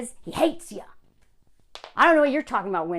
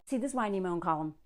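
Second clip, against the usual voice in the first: normal talking voice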